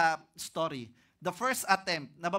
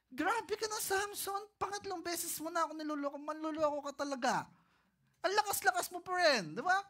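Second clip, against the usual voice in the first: much higher sulky complaint with a pout; pouting Delilah; 8 semitones higher than usual. Acted. Delilah scolds Samson for fooling her thrice, high pout. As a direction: scold him like a hurt sweetheart.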